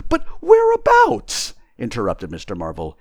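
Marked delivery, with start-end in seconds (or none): 0.1-1.5 very high, loud, drawn-out question; tramp's incredulous outburst; exasperated interruption. Acted. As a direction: butt in with high-pitched bafflement. Tramp interrupts invisible speaker.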